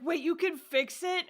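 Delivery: put-on high, eager boyish voice. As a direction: ask it wide-eyed and eager. Mafuyu voiced again, eager.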